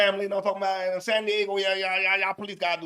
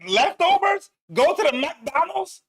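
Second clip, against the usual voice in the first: incredulous echo; 7 semitones higher, slower than usual. Real, not acted. Mocking the wife's leftovers line, incredulous. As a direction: echo her words in disbelief. Mocking disbelief at her words.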